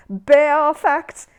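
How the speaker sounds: high laughing. Acted. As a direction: crow over the pun in a high voice. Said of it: "laughing at his own joke in a rather high voice".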